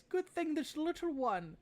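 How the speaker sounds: put-on high, fond old lady voice; old woman. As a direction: pitch up into a grandmotherly old woman. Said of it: "she kind of pats it on the head".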